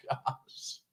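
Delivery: soft breathy giggle sigh. Real, not acted. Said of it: "I'm trying not to laugh".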